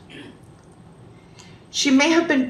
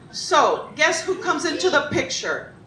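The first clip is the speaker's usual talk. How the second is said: plain reading voice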